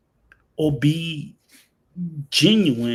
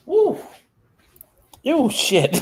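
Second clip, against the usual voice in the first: sudden high yelp of pain, loud; real yelp from a leg cramp; high squeal then pained curse; 13 semitones higher, 4 dB louder, slower than usual. Real, not acted. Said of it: "Rico got a cramp".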